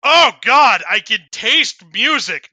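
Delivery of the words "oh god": loud disgusted outburst. Acted. Tastes sparkling water.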